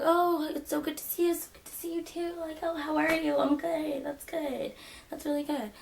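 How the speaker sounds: high, sugary mock-polite voice; fake sweet greeting. To the woman hugging her, a high gushing greeting. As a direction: gush like a fake-friendly acquaintance.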